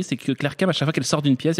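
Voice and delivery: usual speaking voice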